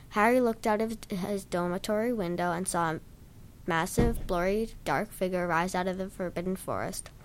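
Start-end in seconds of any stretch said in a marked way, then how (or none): none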